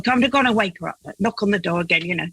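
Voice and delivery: normal talking voice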